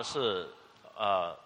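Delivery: normal talking voice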